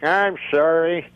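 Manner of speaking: ordinary talk, nothing marked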